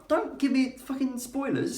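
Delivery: high, fake-indignant squeak; a reader outraged at spoilers; mock outrage.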